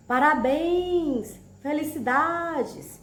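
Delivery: slow, drawn-out happy congratulations; joyful exclamation. Shown as a demonstration. Teacher showing the class how joy sounds, slow and bright. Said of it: "representamos uma alegria".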